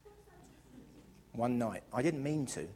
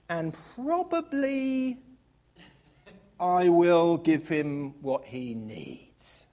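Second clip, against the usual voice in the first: solemn scripture read; 5 dB louder than usual. Acted. Reads scripture grandly.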